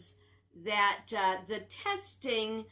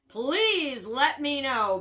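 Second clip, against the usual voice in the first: louder, slower, with a real laugh; laughing challenge; 5 semitones higher, 8 dB louder, slower than usual. Real, not acted. Speaker daring listeners to find sense, laughing louder. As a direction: laugh it out in disbelief. Mocking a dismissive claim.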